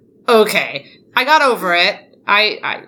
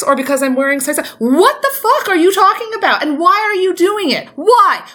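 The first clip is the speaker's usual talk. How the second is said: indignant rant; 3 semitones higher than usual. Real, not acted. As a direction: build the outrage to a cry of why. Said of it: "that was a very well done speech".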